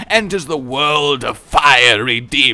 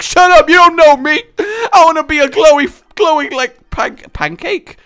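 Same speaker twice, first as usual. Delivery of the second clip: mock outburst; 12 semitones higher, 7 dB louder than usual. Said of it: "I'm like".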